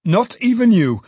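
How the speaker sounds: high and loud, leaning on even; jolly loud cry. A narrator's reading. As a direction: boom it out merrily, stressing even. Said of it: "a generous and jolly voice".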